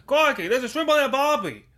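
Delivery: put-on loud, high, over-the-top Australian accent; hammed-up Australian. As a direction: bellow it like a cartoon Aussie. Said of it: "any excuse we have to do an accent".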